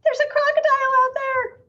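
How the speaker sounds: high, loud alarmed cry; startled bystander's alarm; alarmed gasp. A narrator's reading. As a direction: gasp out a warning. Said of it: "someone gasped".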